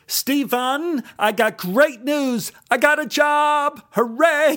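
bursting with excitement as Ron; excited outburst. A narrator's reading. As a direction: explode with joy.

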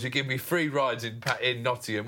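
usual speaking voice

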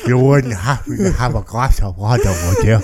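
lightly voiced crusty-mouthed Jim Carrey bit; mock a parched mouth, words sticking; a hint of the dry-mouthed movie character. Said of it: "that's what he sounded".

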